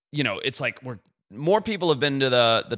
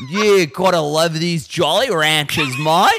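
loud, slow mock Aussie drawl; hammed-up Australian round a wad of sweets; Australian accent, mouth full; 5 semitones higher, 6 dB louder, slower than usual. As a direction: gush as an Australian with a mouthful of candy. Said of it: "nine Jolly Ranchers in his mouth".

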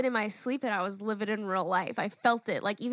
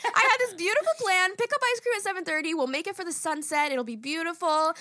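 excited plan; 6 semitones higher, 3 dB louder than usual. Real, not acted. Describing dream plan, wistful.

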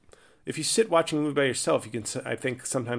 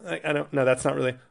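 ordinary talk, nothing marked

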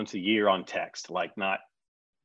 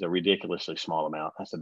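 ordinary delivery

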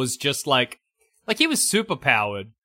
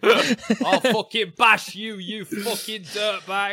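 slow cockney threat; 5 semitones higher, slower than usual. Retold or imitated. Cockney Spider-Man threat.

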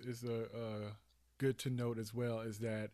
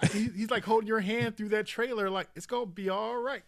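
higher and louder, full of enthusiasm; excited animated talk; 8 semitones higher, 6 dB louder than usual. Real, not acted. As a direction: gush about the moment.

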